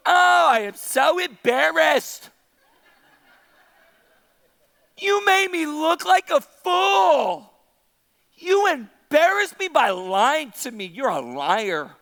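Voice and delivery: drawn-out, hammed-up complaint; Delilah. Preacher plays Delilah accusing Samson, hammed-up embarrassment. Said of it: "And then she goes".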